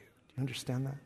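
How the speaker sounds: whispered aside; unvoiced aside to the congregation. Real, not acted. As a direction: lean in and whisper it.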